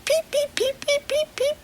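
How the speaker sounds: very high tiny peeps; high chirping chick peeps; chicks peeping. Shown as a demonstration. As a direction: squeak like baby chicks. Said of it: "Try again with me".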